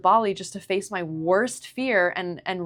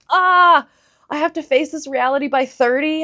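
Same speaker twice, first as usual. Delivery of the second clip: louder and higher, a panicked cry; panicked outburst; 8 semitones higher, 8 dB louder than usual. Retold or imitated. Retelling her panic about facing the reality by thirty, loud. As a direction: spill out the dread of turning thirty.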